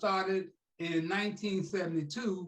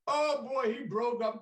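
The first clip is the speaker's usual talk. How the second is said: ordinary talk, nothing marked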